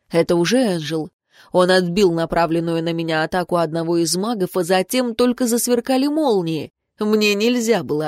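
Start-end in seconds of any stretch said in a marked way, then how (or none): none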